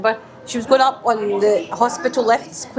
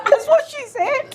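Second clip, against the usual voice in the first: high, slow, laughing words; helpless high-pitched giggle while talking; slower than usual. Real, not acted. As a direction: squeal it out through real laughter. Laughing at own retold lift story.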